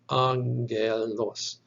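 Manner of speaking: angelos repeated slowly, each syllable stretched; slow, even model of angelos; demonstrated pronunciation. Shown as a demonstration. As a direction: sound out angelos as ang-gel-os, slowly. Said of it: "So this is pronounced".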